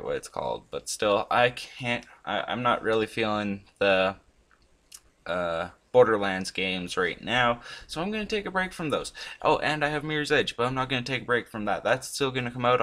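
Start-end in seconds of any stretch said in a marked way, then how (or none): none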